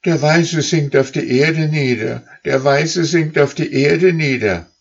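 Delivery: high, loud calling goose voices; high, loud geese crying out. Acted. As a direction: shout the warning up the line. Rear geese report.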